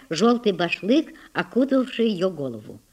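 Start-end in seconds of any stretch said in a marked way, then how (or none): none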